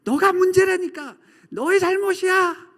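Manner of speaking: high, loud insistent yell; acted heated accusation at full voice. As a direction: yell the blame harder, fed up. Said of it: "더 크게 상대방에게 소리를 지르는".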